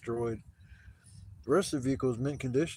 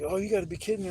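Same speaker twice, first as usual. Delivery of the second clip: raised, higher-pitched disbelief; retold outrage at being cheated; exasperated protest; 8 semitones higher, 4 dB louder, faster than usual. Ripped-off customer protesting to the hot dog seller, exasperated.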